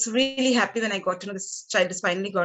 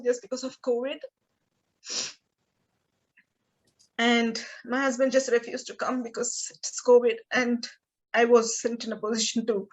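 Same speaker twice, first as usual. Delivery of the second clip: higher, halting voice fighting back tears; emotional, near tears; 3 semitones higher than usual. Real, not acted. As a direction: speak through real tears with long pauses. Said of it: "I'm getting emotional".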